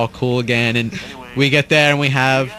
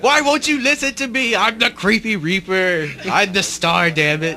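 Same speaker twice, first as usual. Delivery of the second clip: indignant whiny Mike; 9 semitones higher than usual. Retold or imitated. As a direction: demand attention like a spoiled star. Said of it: "pounding on the table".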